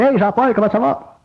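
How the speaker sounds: high, chirpy little voice greeting you; the little inner voice. Retold or imitated. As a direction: play the friendly voice in your head, pitched well up. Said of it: "elle dit".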